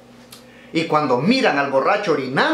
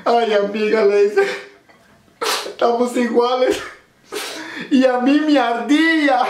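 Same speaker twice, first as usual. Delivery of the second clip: high mock lament; 8 semitones higher than usual. Friend answering the wailing woman, high mock lament. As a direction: answer in a high falsetto with a laugh.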